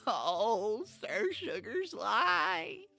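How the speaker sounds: high, loud cracking wail. A narrator's reading. Distraught elf greets the knight, wailing with cracking voice. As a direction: stretch it into a melodramatic elf wail. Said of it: "Elrondo wailed, his voice cracking".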